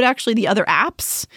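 ordinary talk

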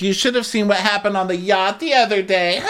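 high, teasing put-on voice; Kenya imitation. Kenya hinting to John about the yacht, high mock teasing. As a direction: tease it out in a high pitch.

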